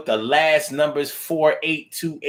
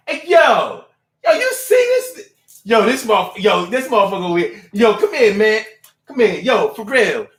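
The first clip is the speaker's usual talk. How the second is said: much higher, loud shouting "hey yo" across the room; imitating a loud guy hollering at his buddy; loud coworker voice; 7 semitones higher, 3 dB louder than usual. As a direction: call out to Chuck like a loud coworker. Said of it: "they have the loud voice like that".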